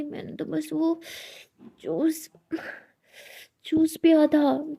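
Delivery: dazed, half-conscious voice; dizzy, fading out. A narrator's reading. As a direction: drag it out as if about to faint. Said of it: "उसको चक्कर आ रहे होते हैं".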